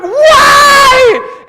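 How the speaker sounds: high, loud panicked yell of why; panicked shout. Retold or imitated. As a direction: shout back in alarm across the waves. Panicked question to friend offshore.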